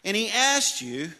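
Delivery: high, loud preacher's voice; preaching. Acted. Pastor preaching to the church, loud and high. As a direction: preach it out to the congregation.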